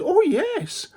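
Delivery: put-on high, bright little-girl yes; eager little girl. Trusting girl agrees to pick flowers, high and eager. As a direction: answer brightly like a trusting child.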